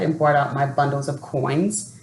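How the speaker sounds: plain reading voice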